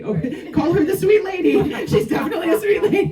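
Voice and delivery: high, loud, fast, laughing through the husband's joke; can barely finish the line for laughing; laughing through quote. Retold or imitated. Husband's joke retold to the audience while laughing hard.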